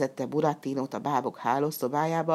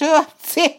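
loud high acted sneeze; explosive mock sneeze; 12 semitones higher, 10 dB louder than usual. A narrator's reading. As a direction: burst out with a short sneeze. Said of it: "A tüsszentés közben".

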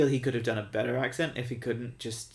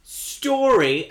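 exasperated rant; 8 semitones higher, 6 dB louder, slower than usual. Real, not acted. Ranting about Star Wars.

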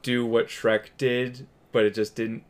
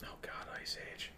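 exasperated whisper; 25 dB quieter than usual. Real, not acted. Groaning at franchise.

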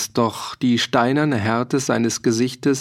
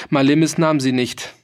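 ordinary delivery, nothing marked

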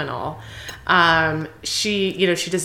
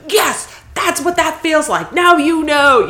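much higher and louder, smug and triumphant; gloating Loki; 10 semitones higher, 7 dB louder than usual. Retold or imitated. Host voicing Loki gloating at Thor after the Hulk smash, smug and high. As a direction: crow it like a vindicated little brother. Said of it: "And Loki jumps up and is like".

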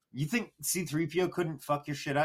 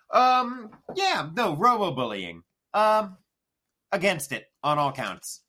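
excited; 6 semitones higher, 5 dB louder, slower than usual. Acted. Mock announcing stance.

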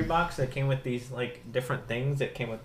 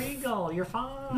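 very high falsetto echo; joking falsetto, kept light; mock reassurance; 12 semitones higher, 4 dB quieter than usual. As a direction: squeak out a playful reassurance. Echoes the joking reassurance higher.

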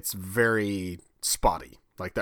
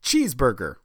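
Kansas accent; 3 semitones higher than usual. Shown as a demonstration. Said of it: "Say that again?".

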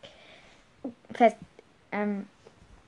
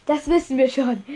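put-on mildly breathless old headmaster, slightly louder; gasped reply; 3 semitones higher, 5 dB louder than usual. A narrator's reading. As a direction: gasp it out, lightly acted. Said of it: "keuchte Dumbledore".

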